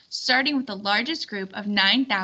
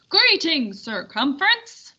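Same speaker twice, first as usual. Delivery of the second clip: raised, higher call across the meadow; calling out greeting; 5 semitones higher, 4 dB louder than usual. Acted. Caravan leader hailing Circumference, raised call. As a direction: hail him from the caravan.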